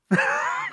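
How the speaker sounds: a high, airy breathy laugh; breathy giggle after shouting; breathless laugh. Real, not acted.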